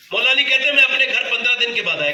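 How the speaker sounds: pitched high and ringing, telling it with fervour. Acted. Preacher opening the tale to the congregation, high and ringing. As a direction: lift the voice, announce the story. First telling of Ali's homecoming.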